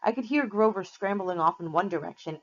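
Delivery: ordinary delivery, nothing marked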